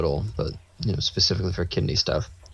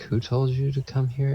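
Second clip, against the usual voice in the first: higher-pitched baffled question; puzzled challenge; 6 semitones higher than usual. Retold or imitated. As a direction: imitate someone questioning in disbelief. Doubting nurse questions the visitors.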